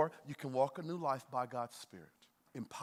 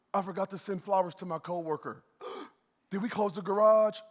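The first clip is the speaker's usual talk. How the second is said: mock panic; 4 semitones higher, 6 dB louder than usual.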